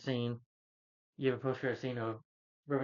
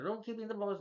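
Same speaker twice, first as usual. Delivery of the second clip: high-pitched retold protest; mock high-voiced complaint; mock pained yelp; 10 semitones higher than usual. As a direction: say it high, as if just hit below the belt. Retold reaction to being punched.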